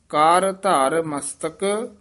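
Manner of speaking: ਕਰ, slight break, ਧਰ, then ਮਸਤਕ, slow; don't run ਕਰ ਧਰ together, part them; slow, separated ਕਰ ਧਰ ਮਸਤਕ; pause between words. Shown as a demonstration. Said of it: "ਕਰ ਧਰ ਇਕੱਠਾ ਨਹੀਂ ਕਹਿ ਦੇਣਾ".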